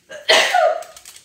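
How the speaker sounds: a loud real sneeze; sneezing. Real, not acted. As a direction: sneeze suddenly and sharply. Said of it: "je sens que je vais éternuer là".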